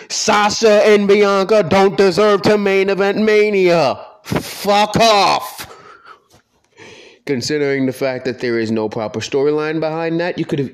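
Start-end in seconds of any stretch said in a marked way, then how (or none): 0.1-5.7 louder, slow, spelling out a hot take; deliberate, punched-out verdict, then a dismissive fuck off; slow emphatic declaration. Real, not acted. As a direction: deliver it like a proclamation to the whole room. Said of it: "let me just say this right the fuck now".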